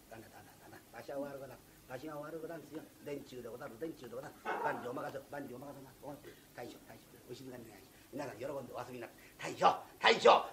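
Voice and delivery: calm them down gently, fawning; soothing jester. Acted.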